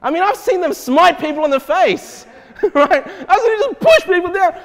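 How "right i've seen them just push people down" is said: high, laughing voice; laughing while talking. Real, not acted. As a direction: push the words out through real laughter. Amused at charismatics pushing people.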